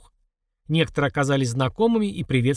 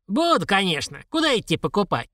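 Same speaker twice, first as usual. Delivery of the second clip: put-on higher-pitched old woman's voice; eager old woman; 5 semitones higher than usual. A narrator's reading. Old woman accepting the onion offer, eager.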